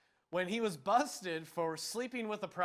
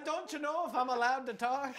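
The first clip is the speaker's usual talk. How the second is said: lightly put-on Midwest accent; a clumsy hint of a Midwest accent, a bit higher; bad Midwest accent; 5 semitones higher than usual. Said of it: "that was just a horrible accent".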